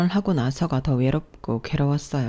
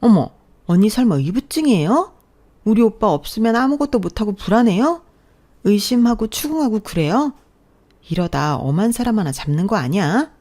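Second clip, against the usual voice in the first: higher, louder mocking sister-in-law voice; sarcastic jab; 6 semitones higher, 6 dB louder than usual. Sister-in-law mocking the wife at the table, sarcastic. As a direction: sneer at her with fake shock. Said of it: "저를 막 비꼬는데".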